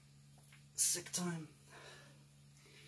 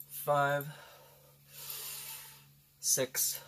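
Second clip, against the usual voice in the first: counting reps while straining; strained counting mid-workout; doing push ups; 8 dB quieter, much slower than usual. Real, not acted. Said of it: "I guess freaking out of shape".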